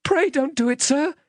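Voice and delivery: put-on high-pitched, frightened child's plea; terrified pleading. Child begging the man who seized him, terrified. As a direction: beg for your life in a high little voice. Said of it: "I pleaded in terror".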